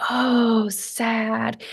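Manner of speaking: soft, sinking "sad"; mimed sadness. Shown as a demonstration. Mother naming her son's mimicked emotion, mimed sadness. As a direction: act out sad, dropping to a hush. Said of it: "use my tone and my words to say the emotion".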